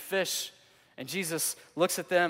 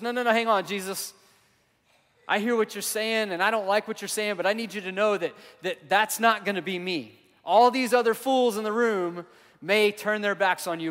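higher, louder insistent protest; bold protest; 5 semitones higher, 6 dB louder than usual. Retold or imitated. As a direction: Peter boldly refusing, rising to a near shout. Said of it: "in his boldness and his competitiveness".

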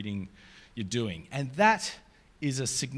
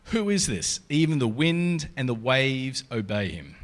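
a measured, louder Bible reading to the congregation; scripture reading; 4 dB louder than usual. Acted. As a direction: read it out to the room like a lesson. Reads scripture passage aloud.

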